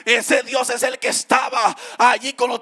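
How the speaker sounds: loud, rising sermon cry with a sung lilt; full-force preaching. Acted. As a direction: preach it out over the music to the congregation.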